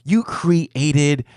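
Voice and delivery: excited retold exclamation. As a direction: exclaim it like an impressed friend. Retells friend's excited realisation on phone.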